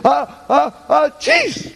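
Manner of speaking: rising high "ah, ah, ah", then a sneezed "chis"; mock sneeze. Profesor imita un estornudo, subiendo agudo. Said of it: "Hay gente que estornuda y dice".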